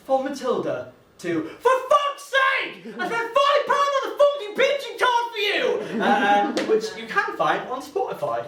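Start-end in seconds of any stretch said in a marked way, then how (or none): 1.6-6.0 bursts out much higher and louder in mock fury; mock outraged yell. Comic telling the crowd about his ex, hamming up a furious yell. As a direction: scream the new playlist name like a vent. Mock outburst at renaming playlist.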